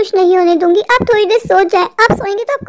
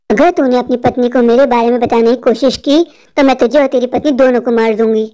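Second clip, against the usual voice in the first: very low, menacing witch voice; low monotone demon-woman; vengeful witch; 9 semitones lower than usual. Acted. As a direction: deliver the threat as a deep-voiced witch. Witch threatens husband and wife.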